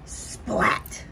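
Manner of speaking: breathless whispered thud; whispered splat. Acted. Butterfly knocked down by wind, whispered splat. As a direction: whisper it like a flop to the ground.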